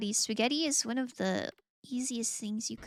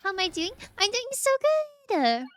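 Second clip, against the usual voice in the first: high, louder, bursting cheer; excited and bubbly; 11 semitones higher, 6 dB louder than usual. Real, not acted. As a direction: beam it out. Answering chat cheerfully.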